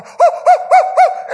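high, loud breathless hoo hoo; high, loud breathless gasps as if drowning; high gasping hoots. Retold or imitated. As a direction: gasp for air in high hoots. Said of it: "that's exactly what I was doing".

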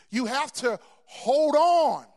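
loud, slow, high exhortation; preacher's roar, drawn out; full-force preaching. Real, not acted. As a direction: lean into it and hold the note.

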